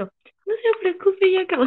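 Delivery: high, very slow sing-song, unbothered; carefree mom. Retold or imitated. Retelling mom's nonchalant reply to neighbor, high carefree sing-song. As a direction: drawl it out sweetly, totally unbothered. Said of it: "bien quitada de la pena, le dijo".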